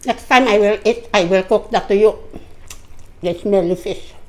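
normal talking voice

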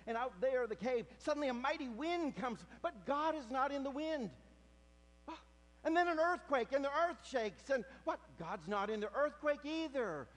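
high, loud preaching build; full-force preaching. Acted. Preacher telling the congregation of Elijah's cave, loudly. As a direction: drive the story home to the congregation.

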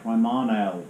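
Primarnell with an L on the end; Primarnell, a mock Bristolian mangling. Shown as a demonstration. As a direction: joke Bristol twist, Primarnell. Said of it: "put an L on everything".

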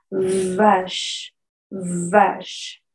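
vache with buzzing v and silent final e; vibrating v. Shown as a demonstration. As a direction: buzz the v in vache. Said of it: "tenemos que vibrar ahí". (vache con la v vibrando y sin la e final)

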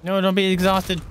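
high, loud protest; exasperated high-pitched cry; dismayed outcry. Real, not acted. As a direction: plead loudly with your game character. Pleads with tired game character.

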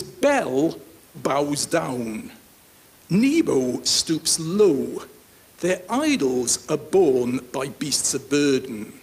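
very slow, weighty Bible reading; measured public reading with long pauses; scripture reading. Acted. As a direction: read the verse out slowly, phrase by phrase. Reads scripture aloud to the congregation.

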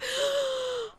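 high gasp of surprise; excited gasp. Real, not acted. Player hears the award announced, excited gasp. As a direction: gasp at the big news.

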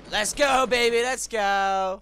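high, loud hype yell; celebrating a clutch play; hype shout. Real, not acted. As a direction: pump it up. Hype after win.

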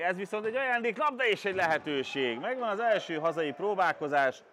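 rising excitement at a chance; excited sports commentary. Acted. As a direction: lift the voice for the chance. Chance called live.

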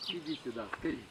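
ordinary delivery

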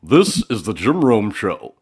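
put-on big, slow, booming old-school announcer. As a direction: boom it out like a fifties radio open. Imitating the original open again.

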